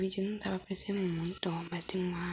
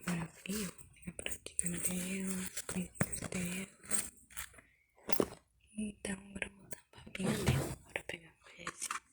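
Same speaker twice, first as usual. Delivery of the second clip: whispering; 5 dB quieter than usual. Real, not acted. Whispers through her doll play.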